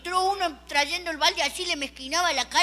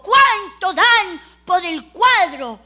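slow, louder bid call; auctioneer call; 4 semitones higher, 8 dB louder, much slower than usual. Retold or imitated. Auctioneer asks for bids, slow and loud. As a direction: draw out the question to the room. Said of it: "el hombre del martillito dijo".

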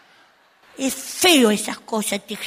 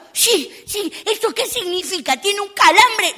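mother; 7 semitones higher, slower than usual. Retold or imitated. Mother answering doctor.

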